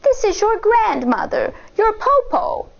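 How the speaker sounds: high sweet fake granny voice; wolf pretending to be grandmother; sugary disguised wolf voice. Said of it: "said the wolf".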